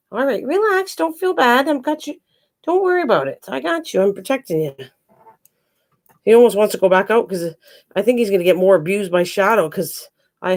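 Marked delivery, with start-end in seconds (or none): 0.1-4.7 high, soothing pet-talk voice; reassuring a pet. Real, not acted. Owner protecting Midnight from Shadow, reassuring in high pet talk. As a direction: comfort a frightened cat in a much higher voice. Comforting the bullied cat.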